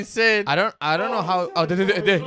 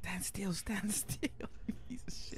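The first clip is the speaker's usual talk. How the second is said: tense hushed whisper; 19 dB quieter than usual. Real, not acted. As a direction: whisper so it won't hear. Hiding from monster.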